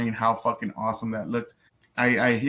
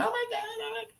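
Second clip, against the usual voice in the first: much higher, softer squealing panic; hammed-up high-pitched fretting; mock Tim Walz panic; 7 dB quieter, faster than usual. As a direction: squeal it like a flustered wimp. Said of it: "He'd be fucking prancing around".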